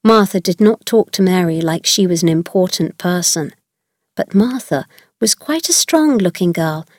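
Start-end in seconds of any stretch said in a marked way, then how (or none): none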